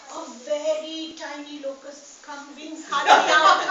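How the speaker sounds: chuckling while describing the tiny locust; laughing through the tale. Acted. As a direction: laugh as the little locust strains. Storyteller voicing the struggling locust.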